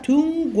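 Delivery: 둥귀 with 둥 stretched long; 둥귀 intoned like Chinese; Chinese-like reading. Shown as a demonstration. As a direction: hold 둥 level, then a short 귀. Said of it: "중국을 둥귀이라고 쓴 게".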